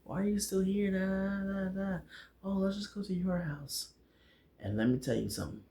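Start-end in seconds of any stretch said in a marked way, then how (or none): 0.0-3.9 high, airy lovestruck imitation; mock infatuated, pitched way up; acting all crazy. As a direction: put on a high, softened gushing voice. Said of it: "acting all crazy".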